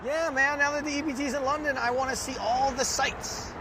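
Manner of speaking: lightly played keen tourist; excited tourist. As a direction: gush like a giddy first-time visitor.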